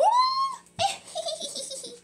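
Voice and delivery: squeaky high giggle. Acted. Kitty boy giggles high while bouncing on his bed.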